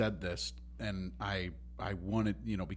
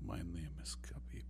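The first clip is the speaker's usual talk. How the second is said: whispered mock Khabib accent, much softer; Khabib ASMR whisper; 6 semitones lower, 14 dB quieter than usual. Cohost playing Khabib in a pretend ASMR video, whispered accent. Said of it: "Khabib A S M R video".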